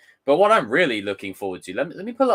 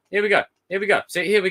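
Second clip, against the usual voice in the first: rising, louder here we go; from mutter to cheer; building excitement; 9 semitones higher, 5 dB louder, faster than usual. Real, not acted. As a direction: hype it up each time.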